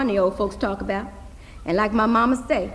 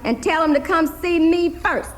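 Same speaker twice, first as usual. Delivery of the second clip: a hint of Daddy, pitched higher and louder; Daddy's voice; 6 semitones higher, 6 dB louder than usual. Daddy from behind his paper telling Hazel, lightly voiced. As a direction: lightly voice the father giving orders.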